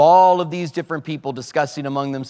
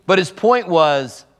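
ordinary talk, nothing marked

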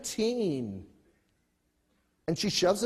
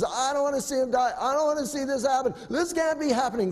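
higher, faster imitation of a freaking-out mother; mock frantic weeping; 7 semitones higher, faster than usual. Hagar cannot watch her son die, mock frantic. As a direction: rush it out in acted panic. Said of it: "She's weeping and crying".

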